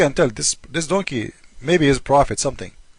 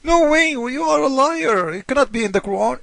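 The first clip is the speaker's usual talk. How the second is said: outraged objector; 7 semitones higher than usual. Acted. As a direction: throw up your hands in mock outrage. Objector rejects the claim.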